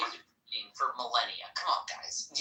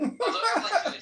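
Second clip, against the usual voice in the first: laughing; 9 dB louder than usual. Real, not acted. Laughing, much higher and louder.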